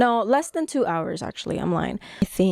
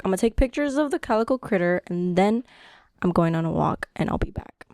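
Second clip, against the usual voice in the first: ordinary delivery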